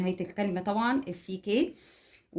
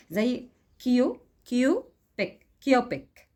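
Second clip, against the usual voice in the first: كيو، بيك said in two clear beats, a gap between; split into syllables; 3 semitones higher, 3 dB louder than usual. Shown as a demonstration. Teacher splitting a word into syllables for the class, in two deliberate beats. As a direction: chop "كيو" and "بيك" apart slowly. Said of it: "بقسمها لمقاطع".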